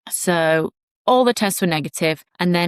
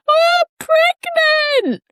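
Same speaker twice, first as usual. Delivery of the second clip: high, louder cry through tears; sobbing announcement; 6 dB louder than usual. Retold or imitated. Telling husband the news while crying, high tearful cry. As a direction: wail it out in happy tears.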